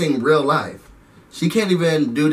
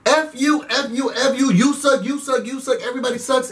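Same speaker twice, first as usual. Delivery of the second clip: mock angry cussing; 7 semitones higher than usual. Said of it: "She's just anger displacing".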